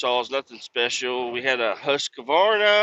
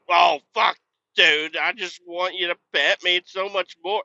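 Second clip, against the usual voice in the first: higher, louder whiny dog begging to be petted; pleading dog voice; 7 semitones higher, 3 dB louder than usual. Pretend. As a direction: play the dog pleading for pets, pitched up. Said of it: "she's just like".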